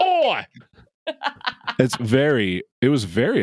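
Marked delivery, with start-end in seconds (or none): 0.0-0.5 high-pitched Aussie "Oi"; mock Australian accent. Co-host echoing host's Foster's joke, high mock Australian cry. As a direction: call it out high in fake Australian.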